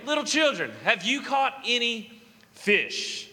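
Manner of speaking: normal talking voice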